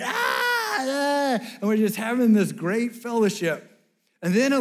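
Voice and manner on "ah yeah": excited cheer. Retold or imitated. Group cheers as Lou arrives.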